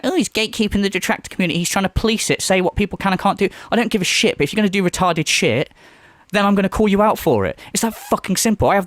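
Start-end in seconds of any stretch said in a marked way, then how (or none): 0.0-3.5 higher, fast, whiny mimicry of critics; mocking critics. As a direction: rattle it off like a snide whiner. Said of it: "people going".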